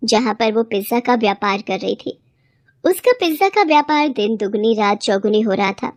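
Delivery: plain reading voice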